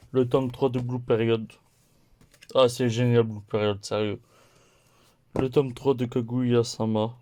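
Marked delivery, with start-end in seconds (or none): none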